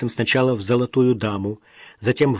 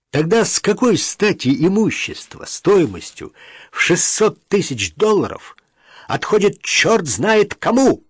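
indignant, higher-pitched demand; flaring up; 7 semitones higher, 3 dB louder than usual. A narrator's reading. Brother flares up at the stranger who inherits, indignant outburst. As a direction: burst out in heated indignation. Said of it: "вспылил тот".

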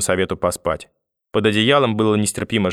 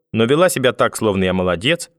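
ordinary delivery, nothing marked

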